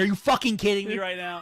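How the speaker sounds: mock angry outburst, laughing. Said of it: "and I say".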